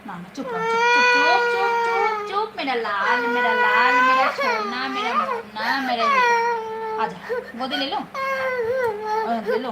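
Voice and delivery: mother hushing her sobbing son with pet names. Real, not acted. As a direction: shush the little one with high, tender pet names. Hushing her crying baby.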